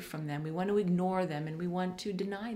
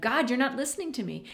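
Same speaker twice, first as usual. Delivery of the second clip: higher, pleading voice, building louder; agonized plea; 7 semitones higher, 3 dB louder than usual. Retold or imitated. David begging God, agonized and pleading. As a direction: voice David's agony as a desperate plea. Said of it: "You can hear through every question David's agony".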